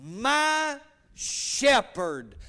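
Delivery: "My shepherd" stretched out very slowly, each word weighted; very slow, dwelling "My shepherd"; slow emphasis. Shown as a demonstration. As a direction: draw out "My shepherd" for the congregation. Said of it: "where he said".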